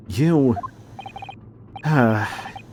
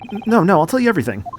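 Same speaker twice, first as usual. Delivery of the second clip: panicked pleading; 7 semitones higher, 5 dB louder, much faster than usual. Acted. Threatened with the cold ocean.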